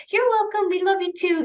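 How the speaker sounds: high, cooing voice for the dog; sweet pet voice. Retold or imitated. Owner cooing thanks back to her grateful Doberman, high and sweet. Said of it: "and we're like".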